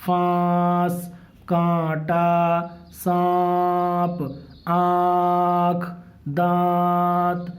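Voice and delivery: فانس، کانٹا، سانپ، آنکھ، دانت very slow, nasal vowels held on one note; nasal ikhfa. Shown as a demonstration. Teacher drills example words, long level nasal vowels in فانس، کانٹا، سانپ، آنکھ، دانت. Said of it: "اسی طرح سے اخفا بھی ہوگا".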